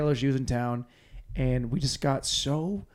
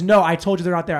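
loud, raised-voice retort; imitating an arguing friend; retold arguing; 4 semitones higher, 9 dB louder, faster than usual. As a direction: fire back in a mock quarrel. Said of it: "then she fights with me".

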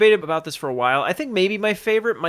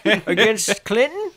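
put-on old man voice; 6 semitones higher, 4 dB louder than usual. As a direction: play a confused old man from the nineties. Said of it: "That's a fun old man voice".

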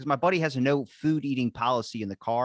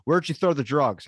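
stern cop; 4 semitones higher, 7 dB louder than usual. Retold or imitated. A cop pulls up and goes.

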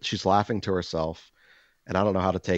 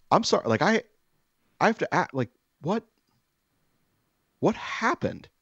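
high, bewildered pleading; panicked disbelief; 12 semitones higher than usual. Retold or imitated. Asking the waitress, baffled and alarmed. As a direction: voice jumps up in alarmed confusion.

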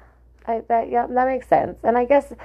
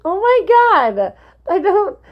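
flustered exclamation; 14 semitones higher, 4 dB louder than usual. Real, not acted. Flustered reaction to confession.

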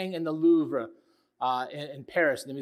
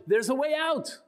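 excited cry; 9 semitones higher than usual. Retold or imitated. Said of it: "he cried out".

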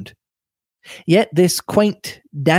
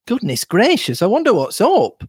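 higher-pitched, wondering exclamation; surprised young Cynthia; 7 semitones higher than usual. A narrator's reading. Cynthia remarks to Lawrence, high and puzzled.

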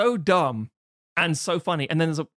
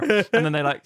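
ordinary talk

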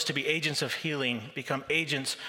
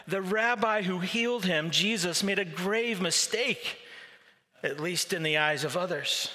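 ordinary delivery

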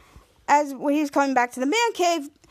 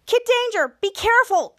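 high, pitched-up mock call; retold worried warning; 8 semitones higher, 3 dB louder than usual. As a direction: imitate a friend calling out a warning. Friend calls warning to hero.

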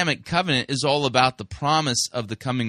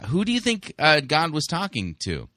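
ordinary talk, nothing marked

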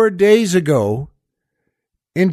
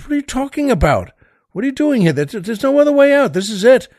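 exasperated Butch; 7 semitones higher, much faster than usual. Retold or imitated. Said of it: "And Newman says".

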